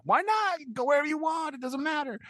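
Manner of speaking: very high-pitched mock protest; exasperated urging. Host plays the man urging Pip to Paris, exasperated urging. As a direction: push it up into squeaky insistence. Magwitch urges Pip on, acted.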